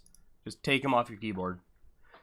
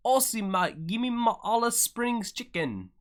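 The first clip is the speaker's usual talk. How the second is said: higher-pitched attempt at an Australian accent; bad Aussie accent; 9 semitones higher, slower than usual. Race host joking about Australia in a badly faked Aussie accent. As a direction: put on a clumsy, high Aussie twang. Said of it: "how to do an Aussie accent".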